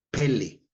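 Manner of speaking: "Pele" with the first E stressed; stress on first syllable. Shown as a demonstration. Preacher reads out a dictionary word, stress on first syllable. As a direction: say "Pele" as PE-le, accent up front. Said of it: "con acento en la primera E".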